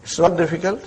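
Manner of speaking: ordinary talk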